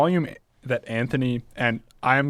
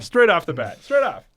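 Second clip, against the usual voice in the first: rising louder and higher; excited build; 8 semitones higher, 5 dB louder than usual. Shown as a demonstration. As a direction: climb up with each repeat. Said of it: "building repetition".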